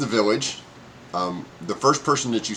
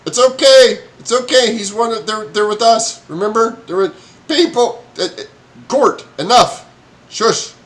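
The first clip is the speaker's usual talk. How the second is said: high, louder calming call; Carl calling out; 10 semitones higher, 6 dB louder than usual. Acted. Mayor Carl calls out to calm the yelling goblin, high and loud. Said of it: "suddenly hear Carl like".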